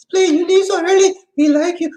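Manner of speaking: high, loud mock plea; imitated pleading. Imitating hotel people who want the couple to stay, high mock plea.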